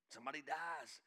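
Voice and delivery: normal talking voice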